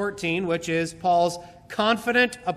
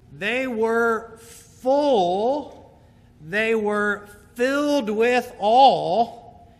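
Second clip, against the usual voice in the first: drawn-out emphasis; 2 semitones higher, 3 dB louder, much slower than usual. Acted. Preacher savoring each word.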